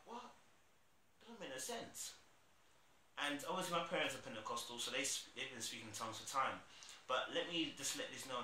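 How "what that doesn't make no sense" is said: high, soft disbelieving squeak; baffled, pitched way up; incredulous. Retold or imitated. About only praying ten minutes, high soft disbelief.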